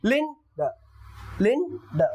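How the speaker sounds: लिन द said as two beats, लिन stressed, द light; split into lin and da. Shown as a demonstration. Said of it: "अब इसको बोलेंगे".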